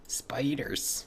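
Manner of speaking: hushed, whispered mock dread; spooky whisper. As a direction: whisper it like a creepy warning. Repeats the word in mock dread.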